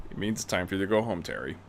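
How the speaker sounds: put-on low, quiet, fast old mentor voice; low quiet stern old man; old man Bruce. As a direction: speak low and clipped like a gruff elder. Bruce tells Terry to leave.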